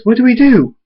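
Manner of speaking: high, loud alarmed question; panicked. A narrator's reading. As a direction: cry it out in panic. Panic as the enemies arrive.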